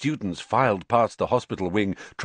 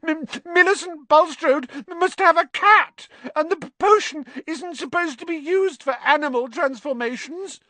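much higher, breaking wail with a stammer; howled; 4 dB louder than usual. Acted. Hermione wails to the boys about her cat face, high and stammering. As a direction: wail it out in distress. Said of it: "she howled".

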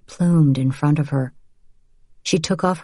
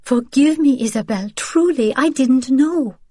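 begging forgiveness; 9 semitones higher than usual. Acted. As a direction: beg your sister, near tears.

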